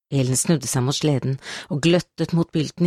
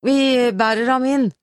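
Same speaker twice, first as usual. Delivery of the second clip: raised, higher-pitched call; 7 semitones higher than usual. A narrator's reading. Man ordering the others to carry the wounded in, calling out. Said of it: "ropte han".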